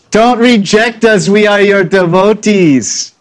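high, slow, loud plea of a longing devotee; pleading gopi. Acted. Gopis begging Krishna not to reject them, high pleading voice. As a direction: beg him not to turn you away, high and slow.

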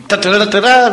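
high, rising mock dog barks; Rin Tin Tin barking. The dog chasing off a stranger dog, with high yappy barks. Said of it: "like Rin Tin Tin or something".

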